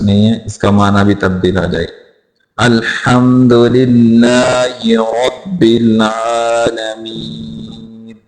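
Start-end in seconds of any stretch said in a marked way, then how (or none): 2.6-8.2 slow, drawn-out tajweed recitation; measured model recital for learners; Quranic recitation. Shown as a demonstration. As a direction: recite the verse slowly, holding the long vowels.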